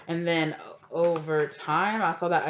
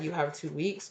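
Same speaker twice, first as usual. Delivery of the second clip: ordinary talk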